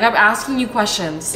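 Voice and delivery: usual speaking voice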